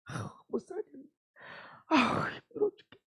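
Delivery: soft, sighing grumble; tired spouse muttering a curse; muttered complaint. Retold or imitated. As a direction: mutter it under your breath, worn out.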